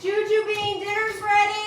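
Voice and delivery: loud high call across the house. Real, not acted. Mom calling kid to dinner.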